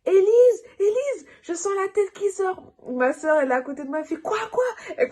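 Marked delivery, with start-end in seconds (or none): 0.1-2.7 high panicked scream of her sister's name. Retold or imitated. Telling her sister in labour, screaming in panic. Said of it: "Je commence à crier". 4.2-4.6 high startled quoi, quoi; alarmed. Retold or imitated. Sister beside the bed, startled and high.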